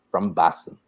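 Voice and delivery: Frank Mulcahy. Retold or imitated. Comic imitating his Boston friend's accent, Boston accent. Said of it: "Boston accents now sound like Frank".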